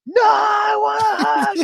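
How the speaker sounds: piercingly high, loud mock child's wail; imitated tantrum scream. Dad imitating his daughter after being refused, screamed crying wail. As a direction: scream it like a child in full meltdown.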